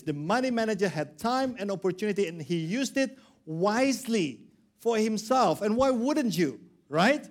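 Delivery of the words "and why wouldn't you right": ordinary delivery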